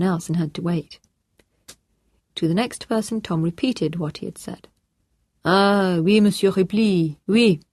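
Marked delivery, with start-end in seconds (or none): 5.4-7.6 put-on louder, French-accented official on the phone; French-accented officer answering the phone; French police officer. A narrator's reading. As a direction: greet him as a brisk French policeman.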